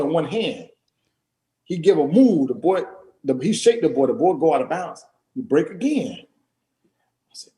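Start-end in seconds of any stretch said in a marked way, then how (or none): 6.2-7.5 whisper. Real, not acted. Awed aside while watching.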